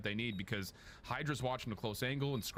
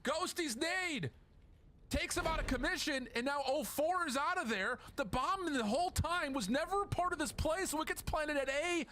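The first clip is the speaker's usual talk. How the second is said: hyped play-by-play; 13 semitones higher than usual. Real, not acted. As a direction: sell the big moment to the crowd. Caster calling a clutch nade.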